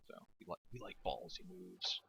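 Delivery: soft breathy whisper; whispered aside. Real, not acted. Caster praising a ballsy move in a whisper. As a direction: drop to a conspiratorial whisper.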